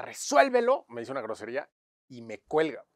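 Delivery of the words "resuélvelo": loud, high imitated yell; retold shout. Client ordering him to fix it before hanging up, retold shout. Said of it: "Y me grita".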